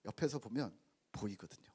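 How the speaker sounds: soft unvoiced whisper; confiding whispered aside. Real, not acted. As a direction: lean in and whisper it.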